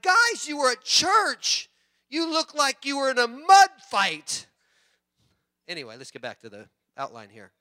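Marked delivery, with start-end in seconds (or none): 0.0-4.5 mock exasperated dad. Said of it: "It's like".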